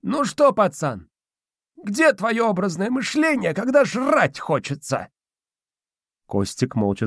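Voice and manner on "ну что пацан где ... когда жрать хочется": put-on high, slow, smirking old spirit; squeaky old man, teasing; smirking domovoi. A narrator's reading. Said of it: "ухмыльнулся".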